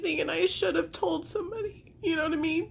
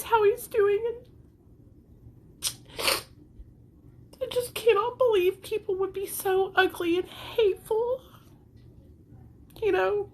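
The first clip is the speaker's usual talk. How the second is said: tearful, higher-pitched voice; crying; 5 semitones higher than usual. Real, not acted. Upset mother defending herself to viewers, crying. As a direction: talk through real tears. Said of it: "The reason why I'm crying".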